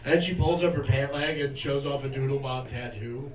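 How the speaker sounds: normal talking voice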